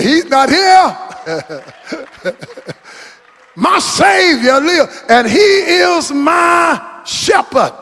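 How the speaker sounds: high, slow shouted preacher's cry; preaching at full force. Acted. Preacher shouting the empty tomb to the congregation. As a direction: lift it up and hold every word.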